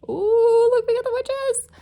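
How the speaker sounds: thrilled at the cards that turned up; squealing with delight over the witches; excited. Real, not acted. Delighted card reveal.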